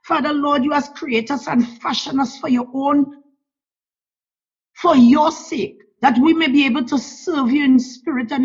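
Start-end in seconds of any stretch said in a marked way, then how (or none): none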